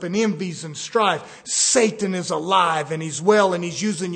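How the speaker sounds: loud, emphatic pulpit delivery; preaching. Real, not acted. Preacher warning congregation about Satan using them, forceful preaching. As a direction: hammer each warning home.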